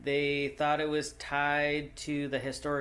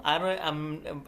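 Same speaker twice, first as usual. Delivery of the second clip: usual speaking voice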